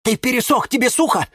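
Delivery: high-pitched excited cry from the plants. A narrator's reading. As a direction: call it out high, in alarm. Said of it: "кричали Вениамин и Валентин". (высокий встревоженный выкрик)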